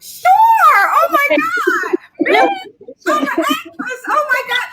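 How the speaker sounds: high, laughing, shrieking delight at the offer; ecstatic squeal. Retold or imitated. Actor reliving being offered the role, squealing with laughter. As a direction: squeal with joy like you just got cast. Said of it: "I was like".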